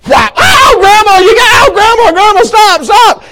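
high-pitched mock wail of a swatted child; pleading little boy. Cornered grandson begs grandma to stop swatting, comic wailing. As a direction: yelp and beg in a high kid voice.

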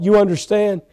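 normal talking voice

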